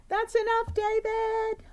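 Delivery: high, loud stern mother calling out; scolding mom. Acted. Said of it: "David's mom always said".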